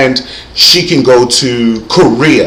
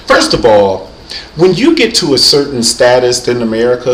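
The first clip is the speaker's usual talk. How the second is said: ordinary talk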